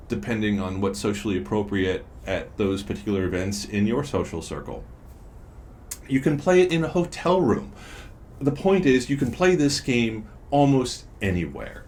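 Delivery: upbeat, high sales pitch voice; ad read. Acted. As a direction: pitch it like a commercial.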